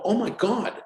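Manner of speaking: oh my God jumps up high; the penny drops mid-sentence; sudden realization. Retold or imitated. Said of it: "I go".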